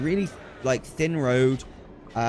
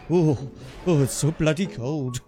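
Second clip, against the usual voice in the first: slightly Welsh-sounding imitation; Welsh accent. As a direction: put on a wobbly, accidental Welsh accent. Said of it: "went a little bit Welsh there".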